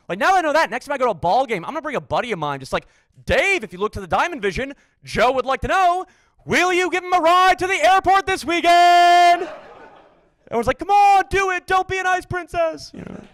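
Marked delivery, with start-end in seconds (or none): 3.2-9.5 mock big-screen announcement. 10.8-12.9 higher, egging-on crowd taunt; mock peer-pressure chant, much higher; mock crowd heckle.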